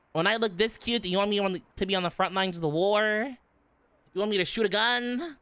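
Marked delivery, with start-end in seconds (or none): none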